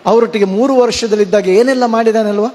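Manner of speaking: usual speaking voice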